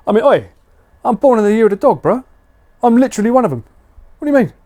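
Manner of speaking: high, loud mock outrage; mock indignant. Streamer mock-protesting to chat, high and loud.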